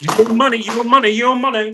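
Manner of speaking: loud, high mock-angry repeated demand. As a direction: imitate a furious clerk yelling about money.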